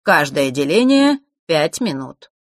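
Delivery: slow, measured explaining; drawn-out polite instruction; obliging explanation. A narrator's reading. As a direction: spell it out courteously. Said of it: "любезно разъяснил Марк".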